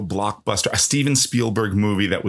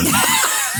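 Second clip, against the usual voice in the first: sudden high shrieking laugh; burst of laughter. Real, not acted. Laughing at own joke.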